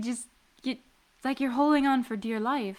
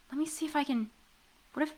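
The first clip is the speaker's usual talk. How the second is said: ordinary delivery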